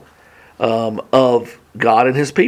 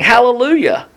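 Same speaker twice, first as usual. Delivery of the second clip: high-pitched, lightly voiced crowd shout; mild shout; 9 semitones higher than usual. Multitude in heaven praising God, mild read-aloud shout. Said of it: "multitude in heaven shouting".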